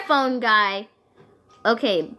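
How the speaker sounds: normal talking voice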